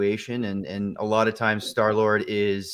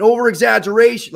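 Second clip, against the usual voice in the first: over-the-top burst; 12 semitones higher, 11 dB louder than usual. Shown as a demonstration. Shows Star-Lord's over-the-top energy.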